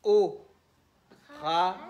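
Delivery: "O" and "ra" as isolated beats with a long pause; syllable by syllable. Shown as a demonstration. Teacher starting the class off, one syllable at a time. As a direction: set out "O", wait, then "ra". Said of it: "syllabe par syllabe".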